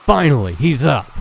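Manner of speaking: gloating villain finally gets his fight. Shown as a demonstration. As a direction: call it out with real punch. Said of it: "I didn't give enough of an inflection on that".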